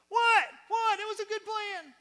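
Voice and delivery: very high, loud exasperated husband; Joseph. Acted. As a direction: yelp in mock bewilderment. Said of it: "and he's like".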